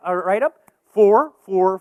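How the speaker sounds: ordinary talk, nothing marked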